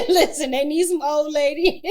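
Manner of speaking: loud, high, slow through laughter; laughing. Real, not acted. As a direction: joke while cracking up. Joking through her own laughter.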